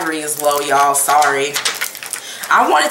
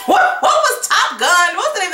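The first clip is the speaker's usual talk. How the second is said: laughing; 11 semitones higher than usual. Real, not acted. Laughing question.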